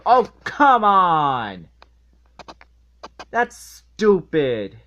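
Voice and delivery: loud, slow, fed-up groan of disbelief; exasperated outburst. Real, not acted. Reviewer yelling at the dumb episode, exasperated and loud. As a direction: throw up your hands and yell at it.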